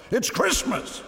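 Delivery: high-pitched indignant retort; exasperated exclamation. A narrator's reading. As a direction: protest it, voice jumping up high. Husband retorts during fire panic.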